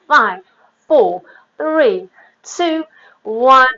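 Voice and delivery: each number called out loud and punchy; coach countdown. Real, not acted. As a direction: count them down loud to drive the class. Fitness coach counting down reps.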